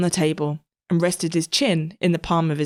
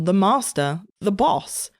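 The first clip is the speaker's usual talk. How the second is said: plain reading voice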